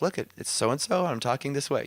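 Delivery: usual speaking voice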